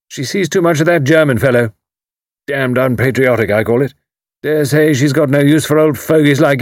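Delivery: loud, high hearty military man; retired commander teasing his neighbour, loud and hearty; bluff old commander. A narrator's reading. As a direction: boom it out like a blustering retired officer. Haydock voiced.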